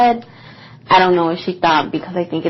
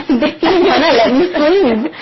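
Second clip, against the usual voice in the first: higher and louder, laughing through it; 7 semitones higher, 5 dB louder than usual. Real, not acted. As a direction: say it while cracking up.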